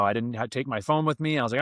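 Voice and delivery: ordinary talk, nothing marked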